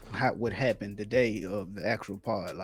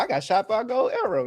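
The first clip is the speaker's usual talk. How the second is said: 1 proud brag; 10 semitones higher, 6 dB louder, faster than usual. Retold or imitated.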